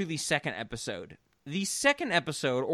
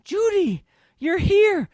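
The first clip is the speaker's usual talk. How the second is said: high, loud shrieking mother; hysterical mom; 13 semitones higher, 5 dB louder than usual. Retold or imitated. Host imitating the mother's hysterical greeting, high shriek. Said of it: "when she's like".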